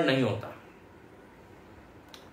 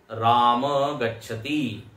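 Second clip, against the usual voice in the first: slow demonstration; 2 semitones lower, much slower than usual. Shown as a demonstration. Teacher demonstrates example phrase.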